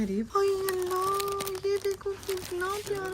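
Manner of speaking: high, slow and delighted; excited gushing. Real, not acted. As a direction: coo over something cute. Gushing over cute baby items.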